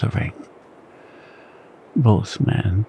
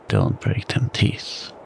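much lower, slow menacing repeat; more ominous; 11 semitones lower, slower than usual. A narrator's reading. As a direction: lean in darker, slower threat. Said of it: "repeated more ominously than before".

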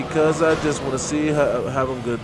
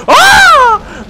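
sudden high yelp of fright; high, loud startled yelp; startled scream; 14 dB louder than usual. Real, not acted. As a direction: shriek as the car blows up. Car explodes.

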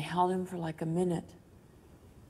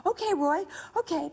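high, hurried-sounding reassurance, retold; high-pitched retold soothing; placating; 10 semitones higher than usual. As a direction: humor him gently but anxiously. Humoring the frantic dying man.

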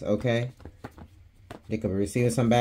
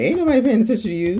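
high, fast dismissive rant; mock scolding; 11 semitones higher, 3 dB louder, faster than usual. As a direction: tell them off in a quick, high-pitched rush. Mock scolding of the attention seeker.

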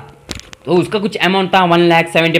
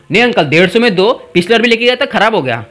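haggling; 6 semitones higher than usual. Retold or imitated. Haggling acted out with uncle.

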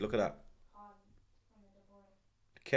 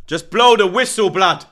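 much louder and higher, yelling at the ref; desperate shout; 7 semitones higher, 13 dB louder than usual. Real, not acted. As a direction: scream it out in despair. Fan begging ref to end.

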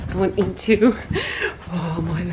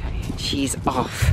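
whispering while hiding; 7 semitones lower, 5 dB quieter, slower than usual. Real, not acted. Seeker nearby.

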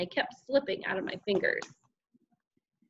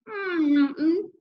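high, muffled hummed denial; closed-mouth mumble; 8 semitones higher, 4 dB louder than usual. A narrator's reading. Boy hiding string in his stuffed mouth, mumbling. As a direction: hum it through sealed lips. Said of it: "I mumbled, trying not to open my overstuffed mouth".

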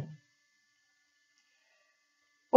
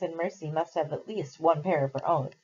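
usual speaking voice

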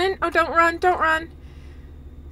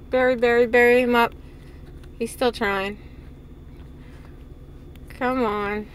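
usual speaking voice

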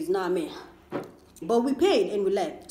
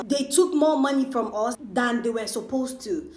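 ordinary delivery

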